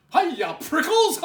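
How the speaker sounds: high, loud, laughing call; bouncy jackrabbit hollering hello; cheery shout. Acted. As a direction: shout it gleefully like a cheeky rabbit. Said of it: "he shouted".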